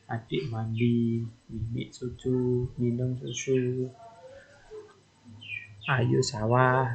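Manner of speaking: put-on slow, simple words like a toddler; slow toddler two-word talk. Shown as a demonstration. Lecturer modelling toddler two-word speech for students, slow mock toddler. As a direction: say each pair of words carefully like a small child. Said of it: "kata yang penting saja".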